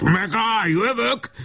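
high-pitched, threatening cry; mock-furious high shout; angry yell. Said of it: "ordította haragosan".

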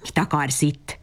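snarling. A narrator's reading. Grumpy smoke-maker snarling at the unwanted visitor Babberci. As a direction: growl the question at the intruder.

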